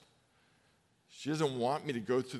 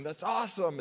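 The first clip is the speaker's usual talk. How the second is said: higher, louder gleeful shout; cheering; 6 semitones higher, 4 dB louder than usual. Retold or imitated. Fan dad cheering a volleyball spike, loud and high. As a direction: whoop at the spike. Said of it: "I'm cheering".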